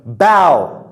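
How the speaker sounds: "Bow" rhyming with cow; ow sound. Shown as a demonstration. Teacher repeating the verb for students, "Bow" with ow. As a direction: say "Bow" with the vowel of now. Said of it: "ow sound".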